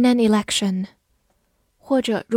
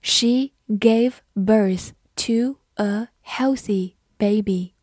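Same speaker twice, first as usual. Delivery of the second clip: slow, deliberate model with gaps between words; slow reading; slower than usual. Shown as a demonstration. Teacher slowly modelling a birth sentence for learners, slow reading. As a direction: say each word slowly and separately. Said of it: "好，慢读一遍".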